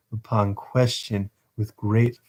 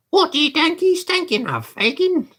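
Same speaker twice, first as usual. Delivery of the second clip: high Dodger voice. Acted. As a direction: drag it out, high and scornful.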